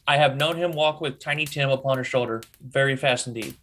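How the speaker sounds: ordinary delivery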